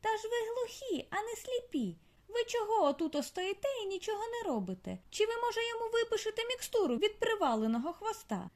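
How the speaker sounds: high, scolding squeak; stern little daughter. A narrator's reading. Daughter mole scolds deaf father, high and sharp. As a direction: tell off the useless old dad.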